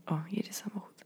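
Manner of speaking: very soft hushed whisper; quiet whisper. Real, not acted. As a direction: whisper so the mic barely hears. A car passes at night. (bardzo cichy szept)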